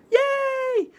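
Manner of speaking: very high, loud squealed yay; fangirl cheer. Real, not acted. Wrapping up the vlog on fangirling, very high squealed cheer. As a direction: squeal it like a thrilled fan. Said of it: "But yeah, fangirling".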